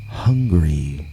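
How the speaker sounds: usual speaking voice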